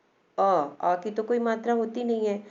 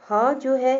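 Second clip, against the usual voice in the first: 1 emphatic h; 6 semitones higher, 7 dB louder, slower than usual. Shown as a demonstration. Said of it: "अब देखिए ह".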